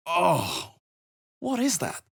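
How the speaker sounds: shocked and revolted; disgusted surprise. Acted.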